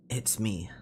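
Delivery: low, whispery weary voice; tired old guy. Shown as a demonstration. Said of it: "a tired voice".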